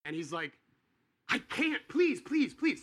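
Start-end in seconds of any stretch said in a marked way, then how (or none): 1.3-2.8 much higher, louder desperate pleading; begging. Retold or imitated. Debtor begging the servant not to jail him, desperate. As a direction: beg for mercy, voice pitched up high. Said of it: "So he's begging".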